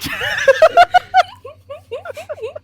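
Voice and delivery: shrieking high laugh; high laughter. Real, not acted. Laughing at rank joke.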